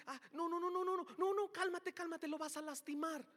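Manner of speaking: hammed-up fretting over a fragile dove; mock panicked believer. Mocks believers fearing hurting Spirit.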